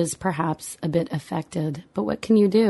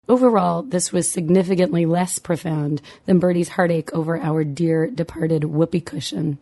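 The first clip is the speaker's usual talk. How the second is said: ordinary delivery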